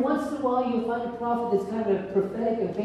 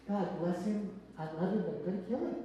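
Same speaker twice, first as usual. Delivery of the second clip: much softer and fast, muttered under the breath; exasperated mom; 10 dB quieter than usual. Acted. Mom muttering after grandpa wakes the baby, soft and fast. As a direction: grumble it fondly but fed up.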